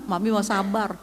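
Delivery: normal talking voice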